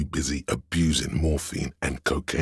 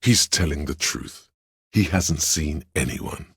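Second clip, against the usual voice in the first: ordinary delivery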